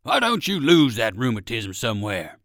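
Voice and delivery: eager, loud dog's plea; old circus dog voice. Acted. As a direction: call out hopefully as the old dog. Percival the dog asks.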